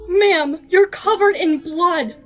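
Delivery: loud, high frightened cry; shocked cry. Acted. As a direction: recoil in shock at the blood. Girl sees blood, shocked.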